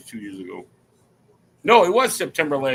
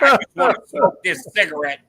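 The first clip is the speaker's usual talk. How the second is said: louder, lightly voiced tired comedian; a hint of a worn-out star brushing off praise; imitating Miss June; 2 semitones higher, 6 dB louder than usual. Miss June deflecting his compliments on deck, lightly voiced. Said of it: "She's like".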